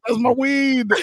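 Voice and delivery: high-pitched protest; alarmed protest. Retold or imitated. Girlfriend nearly threw it away, high alarmed protest. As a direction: cry out to save your stash.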